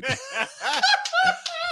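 big high-pitched laugh. Real, not acted. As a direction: burst out laughing. Laughing at name mix-up.

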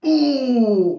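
high-pitched, drawn-out "ooh"; a long, high reaction noise; cringing "ooh". Acted. Comic reacting to easy missed jokes, cringing ooh.

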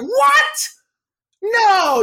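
very high, loud squeal of disbelief; mock shocked. Son learns dad's wild past, shocked squeal. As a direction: yelp it in exaggerated shock. Acted shocked reply to the dentist.

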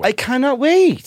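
high, loud, drawn-out eagerness; real excitement. Real, not acted. Comic telling friends about the coming tour, thrilled. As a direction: stretch it out, bursting with anticipation.

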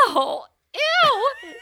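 high, loud startled "ew"; real grossed-out squeal; grossed-out shriek. Real, not acted. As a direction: yelp in disgust. Grossed out by armpit question.

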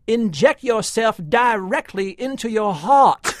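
imitating Will Smith's line. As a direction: ham up the odd film delivery slowly. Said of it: "a more weirdly spoken line".

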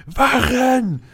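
high, slow outraged cry for help; shouting for guards. Acted. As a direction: bellow it out in holy outrage. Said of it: "er ruft nur".

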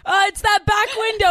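high, loud flustered pointing; awkward high voice. Retold or imitated. Girls telling the mechanic where the window is, flustered high. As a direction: cover the embarrassment brightly. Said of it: "Sophia and I are like".

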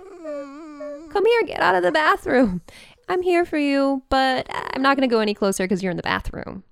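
whimpering dog voice. Retold or imitated. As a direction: coax her owner out gently, a little whimper. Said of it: "she did her little whimper, like".

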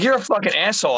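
high, loud indignant insult; retold panicked outrage. Retelling his reaction to the April Fool's joke, high, loud mock outrage. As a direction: act out cursing her in a panic. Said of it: "I was, like, having a panic attack".

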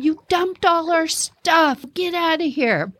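high mock scream, slow at first; yelling at the pranksters, half-acted; imitating an angry scream from a tipped canoe; retold screaming. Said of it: "Those people are screaming".

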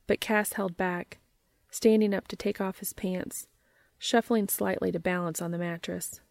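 plain reading voice

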